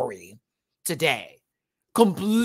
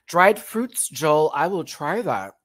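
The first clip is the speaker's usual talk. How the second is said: ordinary delivery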